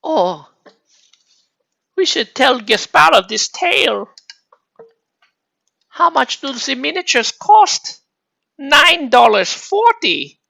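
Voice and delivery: high-pitched, put-on European accent; nondescript European accent. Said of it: "nondescript European accent".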